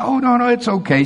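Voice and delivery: put-on high, kind old-lady voice; the old lady putting him at ease; sweet old lady.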